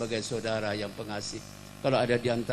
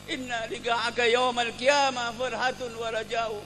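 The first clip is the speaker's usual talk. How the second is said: high, wailing declaration of love; impassioned lament; 11 semitones higher, 5 dB louder than usual. Acted. Ukasyah embraces the Prophet and cries out, impassioned. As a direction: cry out to him with overflowing devotion.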